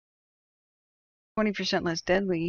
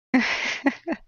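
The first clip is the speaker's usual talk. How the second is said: real laugh; 6 semitones higher, 5 dB louder than usual. Real, not acted. Laughs at Canada joke.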